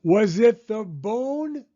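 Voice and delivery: slow, high, loud drawn-out holler. A narrator's reading. Rocket hollers at the vanished bird, slow loud holler. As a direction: holler it long across the yard. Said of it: "Rocket hollered".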